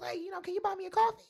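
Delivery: high, quick, breezy mock request; casual ask. Stranger asking her to buy him coffee, high quick casual mock ask. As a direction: toss off the request lightly and fast. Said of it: "He goes".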